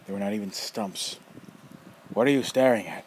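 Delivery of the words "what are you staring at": put-on loud, rough old man snapping a question; gruff challenge. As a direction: call the kid out, sharp and loud. Nefer challenging the boy.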